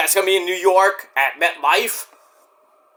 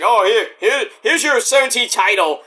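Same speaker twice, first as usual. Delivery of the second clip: sarcastic offering, higher pitched; mock generous handover; 6 semitones higher than usual. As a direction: toss it out like a grudging gift. Mock handing Cena the title.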